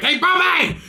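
high, loud yell across the kitchen; mock yell to the cook. Waitress yelling back at the cook for bacon, high and loud. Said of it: "yelling at the fucking cook".

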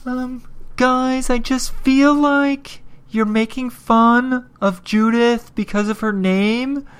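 mock listener accusation. Voices listeners accusing him.

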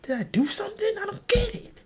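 very high squeaky panic; mock bewildered high voice; panicked falsetto. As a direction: squeak it out like a confused cruise guest. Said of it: "That's what you're like".

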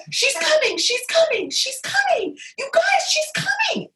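squeaky, repeated warning; mock panic. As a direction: re-enact urgent alarm way up high. Retold panic as baby comes.

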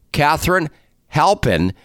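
"Halpin" with a short a as in "pal"; correct pronunciation. Shown as a demonstration. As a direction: model "Halpin" carefully with a flat-tongued short a. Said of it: "That's the correct pronunciation".

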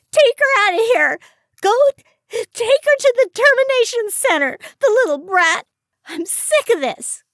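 furious high-pitched shrieking; screaming. A narrator's reading. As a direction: scream orders in a tearful tantrum. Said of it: "Iris screamed".

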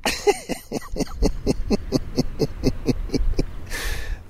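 long breathy chuckle; quiet laugh. Real, not acted. Still amused by the Karen, a soft laugh. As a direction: let out a hushed laugh.

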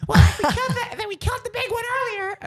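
very high, fast cartoon squeal; screaming Natalie. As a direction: argue back in a frantic falsetto. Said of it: "but she's screaming".

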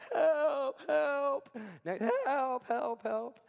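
high, pleading mock cries of help, fading; desperate cry for help. Preacher acting out a frantic call to friends, desperate pleading. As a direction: wail for help like you're drowning. Panicked calls scrolling phone favorites.

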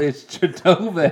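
Chidobe said slowly and clearly, chee-DOH-bay; correct name, slowly. Shown as a demonstration. Correcting a co-host who keeps saying Chipotle, slow and deliberate. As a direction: spell out Chidobe deliberately, each syllable drawn out. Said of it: "It's not Chipotle".

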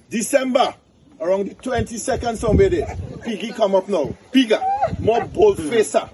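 high-pitched, animated storyteller; folk-tale storytelling. Acted. Tells a donkey folk tale.

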